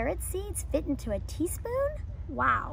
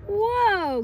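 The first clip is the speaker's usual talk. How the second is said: a higher, louder mock groan; groaned whoa; 8 semitones higher, 6 dB louder than usual. Teacher reading aloud as Tortoise, a higher, louder mock groan. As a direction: play it like Tortoise wobbling in the tree. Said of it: "groaned Tortoise".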